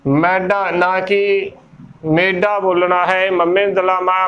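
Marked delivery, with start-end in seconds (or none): 0.1-1.5 ਮੈਡਾ drawn out slowly with the open "æ" vowel; slow careful stress. Shown as a demonstration. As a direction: linger on ਮੈਡਾ to show the right sound. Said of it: "ਬੋਲਣਾ ਮੈਡਾ ਸਾਈਂ".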